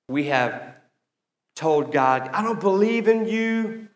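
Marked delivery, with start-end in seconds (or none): 2.3-3.9 high-pitched quoted rejection; mock declaration. Pastor imagines people telling God off, in a raised voice.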